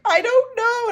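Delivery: high, loud "I don't know" squeal; hammed-up baffled shriek; mock clueless squeal. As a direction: squeal it in playful helplessness. Acting out her own answer.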